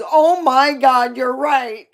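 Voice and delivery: loud, drawn-out "oh my God" as grandma; grandma's shocked gasp. Retold or imitated. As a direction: play grandma stunned by the resemblance. Grandma quoted seeing blown-up picture.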